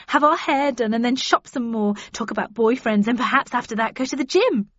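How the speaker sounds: excited, chattering pitch to a friend; eager gushing. A narrator's reading. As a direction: gush through a list of plans. Eager friend pitching a shopping trip.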